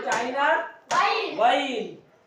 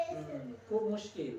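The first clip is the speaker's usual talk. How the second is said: slow, much softer mock sigh; mock difficulty; 3 semitones lower, 12 dB quieter, slower than usual. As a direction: tease that it's so hard. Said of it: "আকার রস্বইকার চেনো না".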